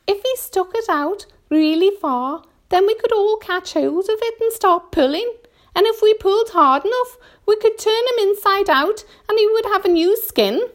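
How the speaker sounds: high, gleeful creature voice; earthworm, smiling a little. A narrator's reading. Earthworm proposes turning the centipede inside out, gleefully. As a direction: build a gruesome plan with growing relish. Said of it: "smiling a little".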